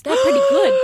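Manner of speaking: high, loud squeaky impression of the boss; hammed-up boss voice; mock impression. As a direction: pitch it way up for the quote. Quoting manager on underdogs.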